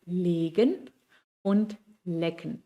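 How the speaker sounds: legen long, lecken short, said slowly; teacher slowly contrasting legen und lecken; German vowel contrast. Shown as a demonstration. Teacher drilling the German words slowly. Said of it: "la comparación de la palabra".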